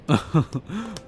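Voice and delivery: condescending little laugh; brief, haughty chuckle; amused chuckle. Acted. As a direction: chuckle at a naive question. Amused at captive wanting to leave.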